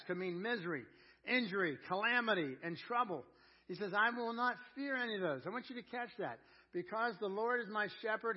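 loud, driving preacher's cadence; fiery pulpit preaching; full-force preaching. Real, not acted. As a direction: preach it out to the room, building hard. Preaching builds on the verse.

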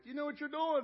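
high, loud alarmed question; mock panic. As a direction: blurt it out like a nervous patient. Acts out panic before going under.

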